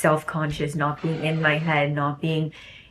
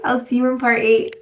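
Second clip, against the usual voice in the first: higher, louder chirpy farewell; perky goodbye; 7 semitones higher, 4 dB louder than usual. Real, not acted. Promising viewers the next part, chirpy and bright. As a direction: bounce the goodbye out.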